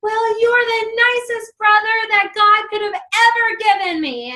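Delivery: high loud praise yelled back; imitating sister's shout. Daughter yelling praise back to her brother across hall. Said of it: "she's like".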